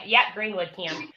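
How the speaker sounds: ordinary delivery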